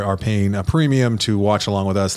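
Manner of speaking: ordinary talk, nothing marked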